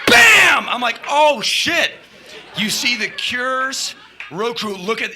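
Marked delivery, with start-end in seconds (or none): 0.1-0.7 high, very loud "bam"; imitated smash. Iggy smashes the footlights, shouted sound effect. 1.1-1.9 louder, higher "uh-oh" exclamation; mock dismay. Watching the footlights smashed, alarmed exclamation. Teller's shock at destruction.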